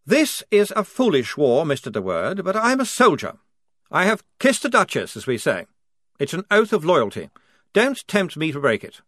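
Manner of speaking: officer refusing the reporter's plea, firmly; earnest lieutenant's speech. Acted. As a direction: stand tall and declare loyalty.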